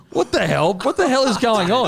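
high, bewildered what the hell; retold disbelief. Retelling his shock at the fighter, high and bewildered. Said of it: "And I was like".

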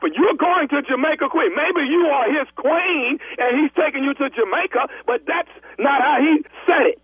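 high-pitched, insistent argument; heated taunting. Real, not acted. Travel agent insisting bride goes to Queens, heated and high. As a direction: needle the caller, drawing out key words.

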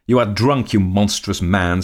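loud, high shriek of contempt; furious scream. A narrator's reading. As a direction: scream the insult at him. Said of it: "screamed my lady".

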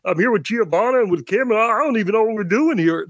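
Ray Romano. Shown as a demonstration. Podcaster showing co-hosts his celebrity impression, Ray Romano voice. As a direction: talk like a complaining TV comedian. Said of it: "I can do Ray Romano".

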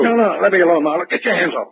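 high, panicked begging; trying to fend off the slaps; scared protest; frightened pleading. Acted. Perdue roughed up by Marlowe.

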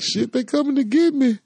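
high panicked yelp; scared. Retold or imitated. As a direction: squeal it out in fright. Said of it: "I'm like".

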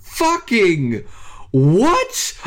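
high, two words split by a pause; stunned, drawn-out disbelief, word by word; incredulous. Real, not acted. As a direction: let "Fucking" fall, then shoot "what" upward. Repeats stunned question, broken in two.

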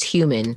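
"human" said HYOO-man, H audible; sounded H. Shown as a demonstration. Correcting a co-host, "human" with its H sounded. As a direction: breathe the H at the start of "human". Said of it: "make that H noise".

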